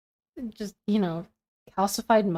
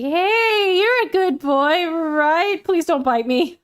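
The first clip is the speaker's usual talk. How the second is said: high singsong placating coo; nervous dog coaxing; 6 semitones higher, 3 dB louder, slower than usual. Pretend. Pretending to pet monster doggy, nervous high coaxing. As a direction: coax a huge dog, hammed-up.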